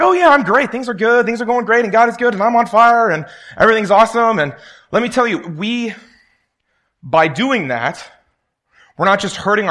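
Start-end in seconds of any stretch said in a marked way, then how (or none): 0.0-4.4 much higher, louder forced upbeat brightness; fake cheerfulness. Preacher mocking his own fake cheerful front to classmates. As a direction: sell a happy front you don't feel.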